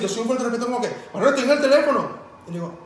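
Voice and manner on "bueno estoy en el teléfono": loud, high irritated snap; mock an annoyed cashier; annoyed clerk.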